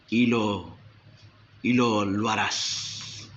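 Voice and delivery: low, slow, fading sinister voice; sinister spirit. Acted. Lying spirit answering God, low and slow.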